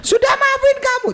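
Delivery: very high, strained mock rant; mock furious forgiveness. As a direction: snap the forgiveness in a rage. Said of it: "tapi sambil ngamuk gitu". (marah-marah sambil bilang sudah mengampuni)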